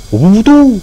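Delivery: high, loud cry; loud call. Real, not acted. Gamer yells at the slow detailing machine, loud and high. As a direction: shout it out at the screen. Shouting at the game.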